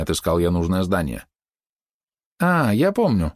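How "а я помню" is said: higher-pitched little exclamation; восклицание лисички. A narrator's reading. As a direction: exclaim it as a fox girl remembering. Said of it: "воскликнула лисичка". (радостно-оживлённое восклицание)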